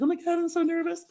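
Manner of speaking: a light, high imitation of nerves; mildly hammed-up jitters; nervous. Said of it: "'cause I was like".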